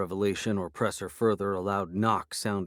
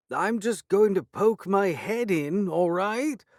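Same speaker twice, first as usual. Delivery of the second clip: high, slow, louder call; 11 semitones higher, 4 dB louder, slower than usual. A narrator's reading. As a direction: call through the door. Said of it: "Lord Dingley called".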